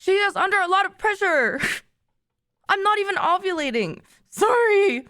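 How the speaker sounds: teary laughing. Real, not acted. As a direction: push through the words while laughing and welling up. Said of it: "I'm gonna cry".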